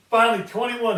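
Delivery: loud, proud announcement; triumphant, slapping the ID down. Retold or imitated. Slapping ID on bar.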